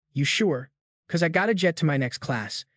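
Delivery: usual speaking voice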